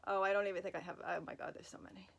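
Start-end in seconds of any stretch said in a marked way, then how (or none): none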